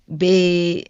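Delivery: "bed" with a stretched Philly vowel; imitated Philly pronunciation of "bed"; Philly accent. Shown as a demonstration. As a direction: mimic a Philly speaker drawing out the vowel in "bed". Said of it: "y'all will say, like".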